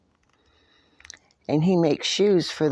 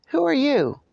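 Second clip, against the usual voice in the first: dog voice; 11 semitones higher than usual. Acted. Dog character asks.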